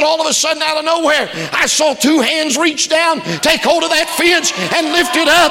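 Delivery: high, loud, shouting preacher climax; preaching at the top of the lungs; full-force preaching. Acted. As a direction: lift the church with a soaring hallelujah shout.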